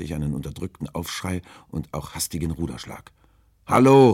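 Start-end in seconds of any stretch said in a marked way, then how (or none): 3.7-4.1 loud, high, irritated call; annoyed shout. A narrator's reading. Sailor calls after the fleeing boat, shouting in annoyance. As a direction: shout angrily after someone. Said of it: "rief ich ärgerlich".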